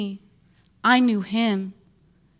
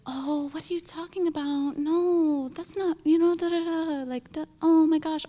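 mock sympathetic friend; 8 semitones higher than usual. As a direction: play the friend brushing it off. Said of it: "the normal reaction or response".

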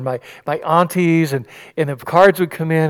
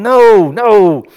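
much louder, higher no no; indignant refusal; 5 semitones higher, 9 dB louder than usual. Acted. Pharisees rejecting Jesus, an appalled loud refusal. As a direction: cut it off, appalled.

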